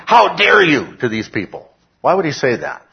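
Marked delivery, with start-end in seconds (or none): 0.0-1.0 raised, high-pitched indignation; indignant outburst, high and loud; emphatic rebuke. Acted. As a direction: quote it as a loud, scandalised challenge.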